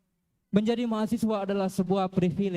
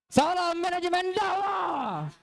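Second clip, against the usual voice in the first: high, slow shouted salute; rally shout; 8 semitones higher, slower than usual. Real, not acted. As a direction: call it out to the crowd. Closing rally salute to crowd.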